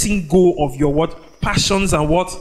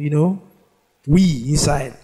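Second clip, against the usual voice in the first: ordinary talk, nothing marked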